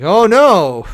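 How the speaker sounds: loud, high mocking "oh no"; mock panic. Mocking people freaking out about being treated badly, loud hammed-up panic. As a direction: mimic them freaking out, hammed up. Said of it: "And they're like".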